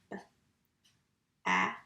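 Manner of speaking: B-A-F as three separate letter sounds; sounded out. Shown as a demonstration. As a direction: tap out each sound of bath with long gaps. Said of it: "we would tap that word".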